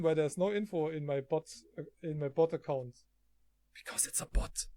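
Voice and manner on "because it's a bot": unvoiced-breath whisper, softer; hushed, whispered punchline. Real, not acted. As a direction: whisper it like an aside. Mocking whisperers on bot channel.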